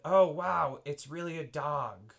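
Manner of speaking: loud delighted surprise; surprised. Acted. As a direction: burst out in surprise. Dad's surprised exclamation.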